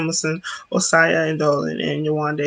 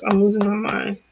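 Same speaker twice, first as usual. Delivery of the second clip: normal talking voice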